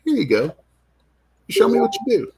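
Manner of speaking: high, bright encouragement. Retold or imitated. Handing the girl a board.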